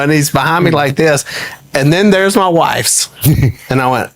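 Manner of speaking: usual speaking voice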